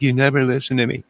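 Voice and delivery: high, loud and fast mock nagging wife; mocking a nagging woman. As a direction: squeak it out like a complaining girlfriend. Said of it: "They were like".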